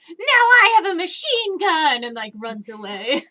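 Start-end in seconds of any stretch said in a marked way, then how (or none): 0.1-2.0 put-on high excited kid yell; kid shouting. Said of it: "one of them's just like".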